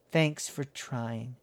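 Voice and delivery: soft, slow, sad thanks. A narrator's reading. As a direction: thank them softly and sadly. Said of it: "but she was blue".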